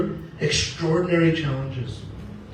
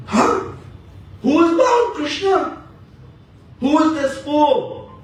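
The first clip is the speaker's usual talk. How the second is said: put-on furious older brother, much higher; Balramji outraged; 11 semitones higher than usual. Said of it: "Balramji sees Krishna's bound up".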